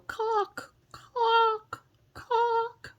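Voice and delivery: very high clucking squawks; high bird squawk. Shown as a demonstration. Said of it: "or go".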